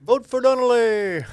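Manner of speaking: high, loud, drawn-out rallying cry; mock campaign shout. Joking about political robocalls, mock rallying shout. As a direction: holler it slowly like a hammed-up campaigner.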